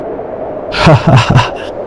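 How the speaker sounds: a short breathy laugh at his friend's boast; hearty laugh. Acted. Habu laughing off Raju's storm boast, breathy laugh. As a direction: laugh it off, amused.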